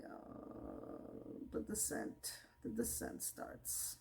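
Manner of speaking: hushed doom-laden whisper; ominous whisper. Pretend. Viewer foreshadowing Miranda's fall, hushed whisper. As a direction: whisper it like a horror trailer.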